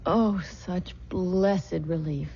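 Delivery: normal talking voice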